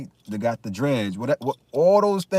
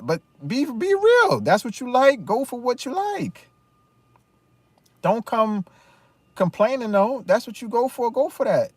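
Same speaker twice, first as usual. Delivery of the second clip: high, pressing rant at women; demanding they just be honest; emphatic call-out; 11 semitones higher, 4 dB louder than usual. Real, not acted. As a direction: call them out, pitch up and push. Ranting, calling out women.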